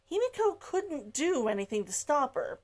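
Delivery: usual speaking voice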